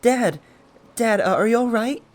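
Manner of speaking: put-on loud, high-pitched child wailing; crying child. A narrator's reading. Child crawling to his trampled father, loud crying. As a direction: cry out in panic like a frightened little kid. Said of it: "crying loudly".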